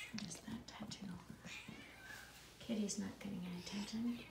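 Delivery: usual speaking voice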